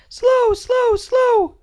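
put-on tiny high toddler chant. A narrator's reading. Little sister echoing the family, tiny high voice. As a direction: squeak it like a baby sister. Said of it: "because she is learning".

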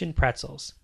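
ordinary delivery

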